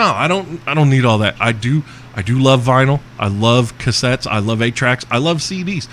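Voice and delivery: ordinary talk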